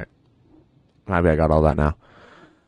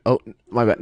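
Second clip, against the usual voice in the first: normal talking voice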